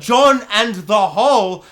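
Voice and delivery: loud, slow, incredulous title announcement; exasperated title reveal. Retold or imitated. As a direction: boom it out slowly in disbelief. Mock-outraged reveal of the late title.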